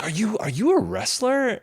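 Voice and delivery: mock awestruck [name]. [name] asks the muscle guy, lightly mock starstruck. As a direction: starstruck little question, half-played.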